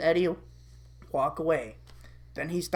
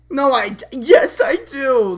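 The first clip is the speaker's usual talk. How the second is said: loud, higher, melodramatic acceptance; gushing change of heart; 7 semitones higher, 9 dB louder than usual. Acted. Naz forgiving Kevin, loud melodramatic gush. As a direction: start to refuse, then gush yes.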